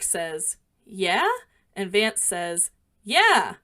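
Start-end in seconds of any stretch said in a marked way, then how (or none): 0.8-1.3 "Yeah" louder with a rising tune; "Yeah" lifted at the end, asking; questioning yeah. Retold or imitated. Reads Kirk's questioning reply. 3.0-3.6 "Yeah" high and loud, then falling; "Yeah" punched up high, dropping at the end; emphatic yeah. Retold or imitated. Reads Vance's emphatic reply.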